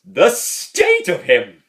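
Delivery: loud, high, drawn-out mock outburst; imitating uncle. Nephew quotes uncle on Father Jack, loud mock dismay. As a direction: exclaim it slowly like an appalled uncle. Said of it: "And then he goes".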